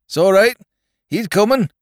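loud country yokel voice; rustic farmer call. Acted. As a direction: reassure like a farmhand. Rustic rescuer reassuring.

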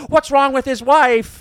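high, loud slow worried question; alarmed about the wife; imitating Peter's alarm. To the friend, worried about the wife, loud and high. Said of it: "he goes".

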